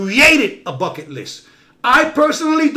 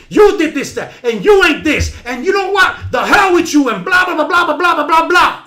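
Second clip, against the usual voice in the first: mimicked argument; 6 semitones higher, 4 dB louder than usual. Acting out finger-pointing argument.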